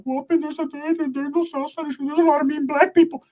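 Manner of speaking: whisper-worried, slowed down; scared cousin. Retold or imitated. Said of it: "my cousin's like".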